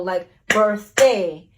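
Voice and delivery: birthday split slowly into birth and day; punching each syllable. Shown as a demonstration. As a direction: say birthday slowly, one beat per syllable. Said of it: "clap each syllable of the word".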